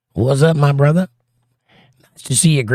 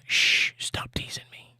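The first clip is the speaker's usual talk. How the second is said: whisper; 11 dB quieter, slower than usual. Acted. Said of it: "it smiles and says".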